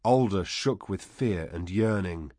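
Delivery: plain reading voice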